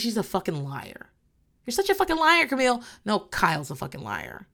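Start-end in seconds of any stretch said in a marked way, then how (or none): 1.6-2.8 high, hammed-up imitation of a catty accuser; sneering impression, pitched up and louder; mock accusation. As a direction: parody her jab, pitched up high. Mocking a cast member's accusation.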